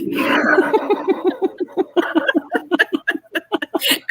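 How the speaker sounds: breathless high wheezing laugh. Real, not acted. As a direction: laugh silently till it wheezes. Laughing at own voice.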